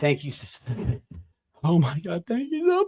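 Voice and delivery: ugly cry. Pretend. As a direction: ham up a blubbering thank-you. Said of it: "thirty-second audio clip of this ugly cry".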